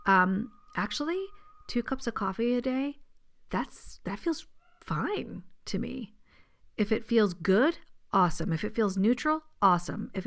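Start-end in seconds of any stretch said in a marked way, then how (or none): 3.5-6.1 slow, unvoiced whisper; slow hushed inner voice. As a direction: whisper it slowly, as if your gut is talking. Voicing her own intuition's answer.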